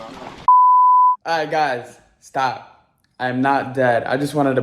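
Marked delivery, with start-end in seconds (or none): 0.0-1.5 excited reveal. Acted. Prank reveal after fake death.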